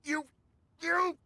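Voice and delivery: flustered fury at being exposed; sputtering outrage. Acted.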